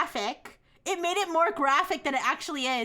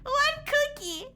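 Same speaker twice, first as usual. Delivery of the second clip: mock baby talk; 10 semitones higher, slower than usual. Reading AI's baby explanation.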